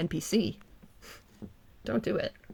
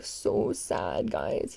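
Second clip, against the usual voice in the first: mock pity; 12 semitones lower, much slower than usual. Real, not acted. Mocking the sad face.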